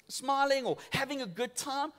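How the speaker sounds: usual speaking voice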